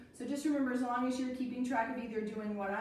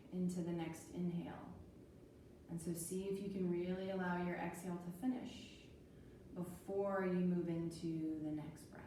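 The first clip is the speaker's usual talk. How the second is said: calm guided breathing; 4 semitones lower, 7 dB quieter than usual. Acted. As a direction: guide the breath gently and calmly. Leads calm breathing practice.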